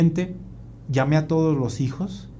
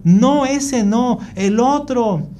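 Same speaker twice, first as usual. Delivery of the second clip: put-on high, drawn-out, louder kid's protest; demanding child; 8 semitones higher, 4 dB louder, slower than usual. Son refusing the blue glass, high stubborn protest.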